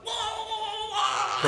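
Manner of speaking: very high, drawn-out comic wail; long squealed whoas, hammed up; mock wailing whoa. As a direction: imitate a dad's alarmed falsetto howl. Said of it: "How did you say that just now?".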